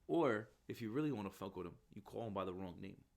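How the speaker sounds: ordinary talk, nothing marked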